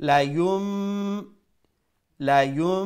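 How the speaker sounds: লা ইউম recited with a long nasal m; chanted লা ইউম, lips closed on a sustained m; with ghunnah. Shown as a demonstration. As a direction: hold the hum on the m of লা ইউম. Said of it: "আর গুন্নার সহিত".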